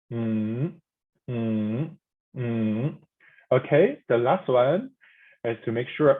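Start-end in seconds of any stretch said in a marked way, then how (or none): 0.1-2.9 low "mm" dipping and coming back up; deep tone. Shown as a demonstration. Teacher repeating the third tone for learners, deep tone. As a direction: scoop "mm" down then up. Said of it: "This is a deep tone".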